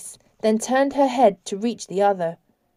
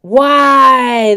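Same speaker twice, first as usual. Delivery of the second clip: high, very loud anguished roar; furious drawn-out cry; 5 semitones higher, 11 dB louder than usual. A narrator's reading. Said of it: "the dark, dark angel roared".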